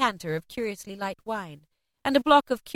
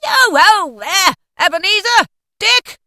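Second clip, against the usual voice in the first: high, booming, drawn-out holler; rich, oily, jovial old master's call; 11 semitones higher, 13 dB louder than usual. A narrator's reading. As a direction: summon the lads with hearty gusto. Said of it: "called out in a comfortably oily, rich, fat, jovial voice".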